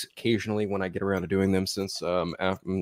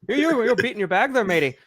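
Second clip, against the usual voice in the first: put-on Irish accent; 12 semitones higher than usual. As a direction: tease him in a leprechaun brogue. Mocking friend in a put-on voice.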